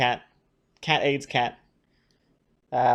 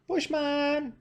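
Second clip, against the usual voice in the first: cooing to the cat; 10 semitones higher, 6 dB quieter than usual. Real, not acted. Coaxing the cat quietly.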